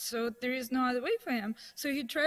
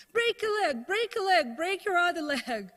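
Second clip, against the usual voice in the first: high, loud mock yelling; retold yell; 6 semitones higher, 5 dB louder than usual. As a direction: yell it after someone leaving, as an acted-out taunt. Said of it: "she yelled at him".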